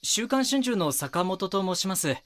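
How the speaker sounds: loud, high-pitched polite announcement; formal doorstep introduction. Acted. Reporter introduces himself at a neighbour's door, calling out. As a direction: project your name through the door.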